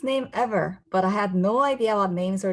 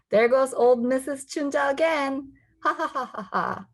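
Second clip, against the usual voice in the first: put-on teasing kids; 6 semitones higher than usual. Kids tease her name.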